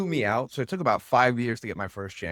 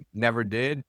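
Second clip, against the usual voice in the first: ordinary talk, nothing marked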